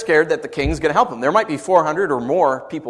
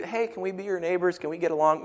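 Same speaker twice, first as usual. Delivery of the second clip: mock friendly invader; 4 semitones higher, 7 dB quieter than usual. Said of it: "They weren't just moving in to be".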